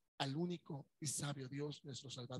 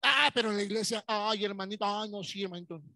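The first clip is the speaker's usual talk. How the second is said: loud gushing churchgoer greeting; hammed-up pious churchgoer; fake church sweetness; 2 semitones higher, 8 dB louder than usual. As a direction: overdo the syrupy hello to a brother.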